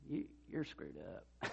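soft, slow, breathy confession of a hard truth; hushed rebuke. Retold or imitated. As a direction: say it gently, just between the two of you. Private rebuke to a friend.

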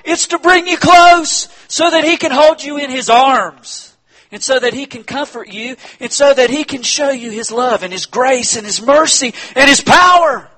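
high, loud impassioned preaching; rising preacher's cry; full-force preaching. Acted. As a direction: preach it out to the back row.

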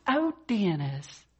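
high, drawn-out motherly exclamation; acted delighted surprise. Mother turns and spots her visiting son, acted surprise.

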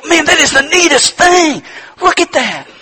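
high, loud, delighted exclamation; thrilled parent. Acted. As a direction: burst out with joy at the newborn. New parent marvels at child.

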